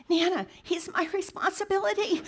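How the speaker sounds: put-on high, earnest child voice; lightly voiced small boy; imitating a little boy. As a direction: plead like a worried four-year-old. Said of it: "he turns around to me and goes".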